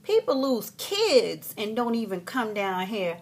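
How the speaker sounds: loud, drawn-out dismissive put-down; mock incredulous attitude, opening high; imitated sassy clerk. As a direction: scoff as the counter lady, slowly. Voices lady behind baggage counter.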